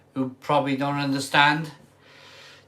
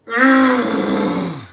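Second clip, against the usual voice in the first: mock heavy sigh; 5 semitones higher, 6 dB louder than usual. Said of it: "I'm gonna cry again".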